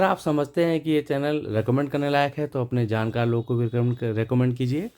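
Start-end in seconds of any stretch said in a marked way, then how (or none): none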